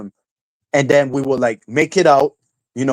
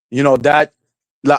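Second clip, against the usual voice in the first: usual speaking voice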